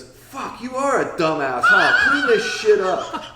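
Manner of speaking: high, loud laughing rant; angry yell. Retold or imitated. Kyborg soaked on the couch yelling at the clumsy narrator. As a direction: yell at him, half laughing. Kyborg woken by water spill.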